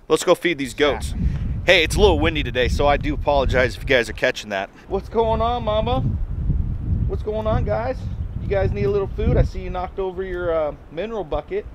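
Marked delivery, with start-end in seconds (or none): 4.9-11.7 talking to pets. Real, not acted. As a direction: greet your animals in a raised, gentle voice. Greeting the goats like pets.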